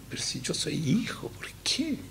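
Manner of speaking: plaintive whine leaping high on the last words; hammed-up complaint, thinning to an airy high end; mock lament. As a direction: imitate a wronged child pleading. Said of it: "después estamos llorando y clamando".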